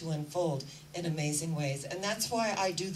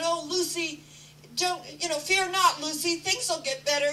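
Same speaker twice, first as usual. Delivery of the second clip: much higher, louder cheery Charlie Brown; Charlie Brown consoling; 10 semitones higher, 5 dB louder than usual. Retold or imitated. Charlie Brown comforts crying Lucy, high and eager. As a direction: coax her like an eager cartoon boy. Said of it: "Charlie Brown came up and said".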